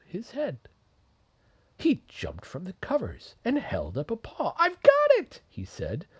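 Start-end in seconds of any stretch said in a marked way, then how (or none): 4.6-5.4 very high, loud eureka squeak; excited mouse. Acted. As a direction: burst out with the bright idea. Mouse's sudden idea.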